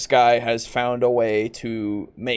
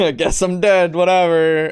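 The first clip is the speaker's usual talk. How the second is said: laughing resignation; 6 semitones higher, 7 dB louder than usual. Acted.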